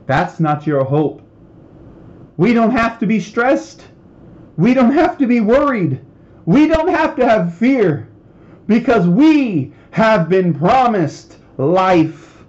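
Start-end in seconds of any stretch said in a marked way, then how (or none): 2.3-12.4 high, fervent proclamation, slowing at the end; impassioned preaching. Real, not acted. Preacher telling believers they need not fear, impassioned. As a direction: drive it home with raised pitch and force.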